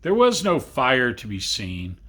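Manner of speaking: ordinary delivery, nothing marked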